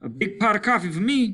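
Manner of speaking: fast, high, loud words while running; breathless cheerful run; panting, smiling. Acted. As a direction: gasp it out happily mid-run. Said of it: "panted Simmons, smiling".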